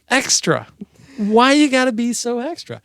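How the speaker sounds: high, loud sassy voice; flamboyant sass. Retold or imitated. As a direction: camp it up, diva style. Acting out flamboyant person.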